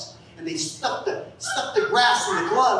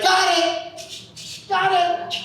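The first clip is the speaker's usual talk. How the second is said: Merle from the trunk; 10 semitones higher, much slower than usual. Retold or imitated. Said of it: "He'd be like".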